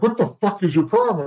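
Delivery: hammed-up shocked yell at the kid; [name]'s outburst. [name] yelling at brawling teen.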